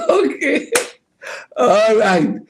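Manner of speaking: high, still laughing as he regains control. Real, not acted. Host pulling himself together after laughing, still giggly. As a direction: settle the giggles into okay. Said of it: "why I'm laughing".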